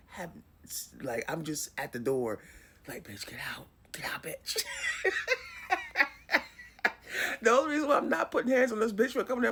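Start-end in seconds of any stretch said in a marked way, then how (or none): 3.0-4.6 urgent hushed order; barely voiced, pushing someone out quietly; keeping it quiet. Storyteller ordering a visitor out at the door, hushed. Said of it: "trying to keep the fucking noise down". 4.7-6.6 high burst of laughter; laughing. Real, not acted. Storyteller cracking up at her own retold standoff, high laugh.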